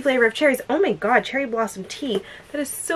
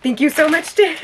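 overjoyed thanks; 4 semitones higher, 6 dB louder than usual. Real, not acted.

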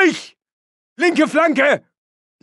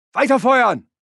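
ordinary talk

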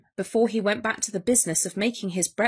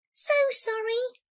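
high, polite little voice; apologetic mouse; 12 semitones higher, 3 dB quieter than usual. A narrator's reading. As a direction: squeak a meek apology. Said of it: "said the mouse".

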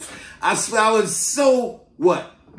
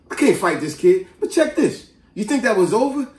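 usual speaking voice

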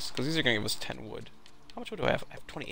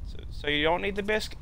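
high, polite put-on voice; eager-to-please neighbour; 8 semitones higher, faster than usual. Neighbour offering the guys a biscuit, high polite voice.

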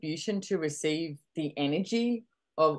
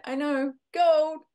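high, slow and louder delight; mock gleeful; 11 semitones higher, 6 dB louder, slower than usual. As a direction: savor the perfect parking spot. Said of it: "And I went".

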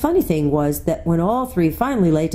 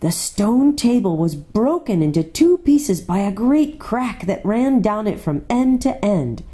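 usual speaking voice

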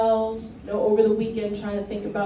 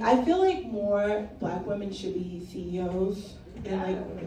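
ordinary delivery

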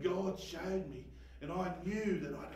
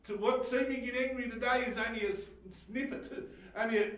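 usual speaking voice

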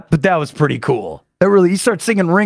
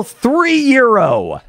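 high, slow, drawn-out price; mock hotel staff; 7 semitones higher, much slower than usual. Hotel charging for a towel, mock drawn-out price. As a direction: stretch out "Three euro" mockingly. Mimicking hotel nickel and diming.

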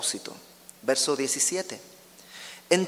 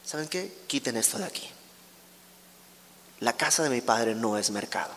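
soft, restrained firm request; acted Jesus speaking softly and calmly; calm, not shouting; 4 semitones lower, 5 dB quieter than usual. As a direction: say it quietly, keeping control. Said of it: "no les grita, les dice".

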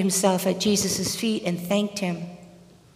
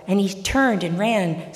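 ordinary talk

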